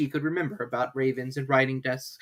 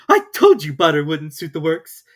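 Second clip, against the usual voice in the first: louder scolding; cross Hatter; 4 semitones higher, 11 dB louder than usual. A narrator's reading. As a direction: blame the Hare. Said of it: "looking angrily at the March Hare".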